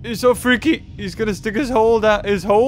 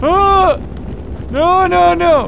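high panicked screams; scream, terrified; screaming in fright; 11 semitones higher, 4 dB louder, much slower than usual. Real, not acted. Attacked by creature.

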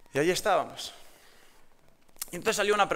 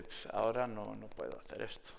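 much softer Spanish inner thought; inner thought, hushed; 5 semitones lower, 11 dB quieter than usual. Retold or imitated. Preacher voicing his own panicked thought at work, much softer. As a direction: drop to a private murmur to yourself. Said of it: "Y pensé".

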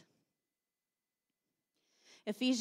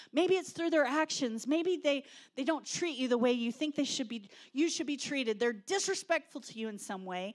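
ordinary talk

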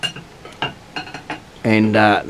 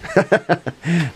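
amused laugh; 8 semitones higher than usual. Real, not acted. Teased about being evil.

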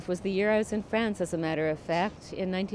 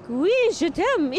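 romantic French; 9 semitones higher, 5 dB louder than usual. Acted.

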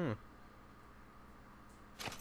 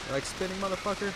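taunting; 9 semitones higher, faster than usual. Real, not acted. Taunting the enemy again.

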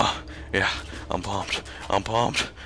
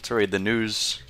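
ordinary talk

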